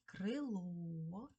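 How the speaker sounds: крило with a long stressed last vowel; stress on final О. Shown as a demonstration. Teacher showing children where stress falls, крило stressed on О. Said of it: "наголосна О".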